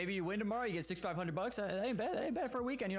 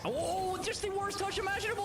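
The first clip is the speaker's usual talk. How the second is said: high, excited casting call; excited reaction; 14 semitones higher than usual. Real, not acted. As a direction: react to the play with a jolt. Reacting to a goal live.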